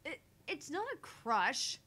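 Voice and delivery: stammered, loud, high denial; flustered protest. Acted. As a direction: protest slowly and emphatically after a stumble. Flustered denial when teased.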